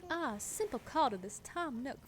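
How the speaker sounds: high, swooping Southern belle; Elise, Southern accent. Acted. Villager talks of Tom Nook, put-on Southern accent. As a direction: lay on the Princess and the Frog accent. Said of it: "I love her accent".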